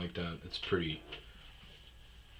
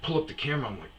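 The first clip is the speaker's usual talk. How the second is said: normal talking voice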